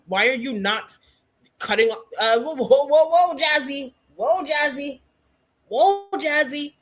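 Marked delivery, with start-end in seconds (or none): 2.4-6.7 high, stretched whoas to stop someone; mock-alarmed interruption. Real, not acted. Host halting Jazzy mid-session over a questioning answer, mock-alarmed. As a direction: pull them up short, pitched high.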